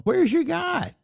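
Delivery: high-pitched sing-song jeer; mimicked mockery in a high put-on voice; mocking taunt. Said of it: "my adversaries taunt me".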